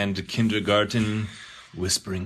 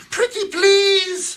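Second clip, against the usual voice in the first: very high, loud and slow plea; pleading; 10 dB louder, slower than usual. Acted. Boy kneels before Santa, begging in a high, loud slow plea. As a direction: draw out a desperate begging.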